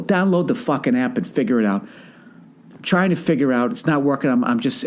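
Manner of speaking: normal talking voice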